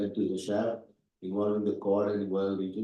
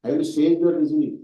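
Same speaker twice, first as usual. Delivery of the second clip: loud, raised-pitch demand; cross examination; 7 semitones higher, 11 dB louder than usual. Real, not acted. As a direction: pin the student down. Examiner grills student.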